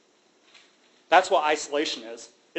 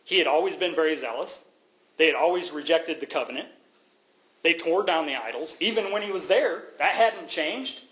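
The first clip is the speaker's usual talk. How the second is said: ordinary talk, nothing marked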